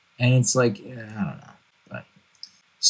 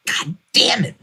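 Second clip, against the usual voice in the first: frustrated curse; 10 semitones higher than usual. Pretend. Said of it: "And you're like".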